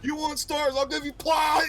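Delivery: high, loud cheesy yell; mock shout. Joking about the ruined line, a hammed-up yell. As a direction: yell the one-liner like a hammy hero. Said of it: "She just, like, fucking flies forward".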